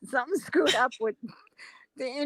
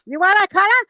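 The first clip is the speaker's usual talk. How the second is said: put-on raised, louder Chinese-accented question; mock Chinese accent; 6 semitones higher, 6 dB louder, faster than usual. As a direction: imitate a nail tech's Chinese accent. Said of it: "she does a perfect Chinese person".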